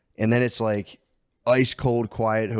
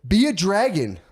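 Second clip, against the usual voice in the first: cheery dad voice; 9 semitones higher, 4 dB louder than usual. Retold or imitated. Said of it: "I call 'em like".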